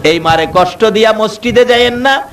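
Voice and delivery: high, sung-out waz chant building to a shout; preaching at full force. Acted. Preacher to the congregation, rising sung waz chant. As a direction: lift the sermon into a wailing chant.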